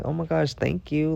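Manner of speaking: high-pitched, relieved thanks; re-enacting delighted gratitude; retold grateful gushing. Acting out thanks to the helper.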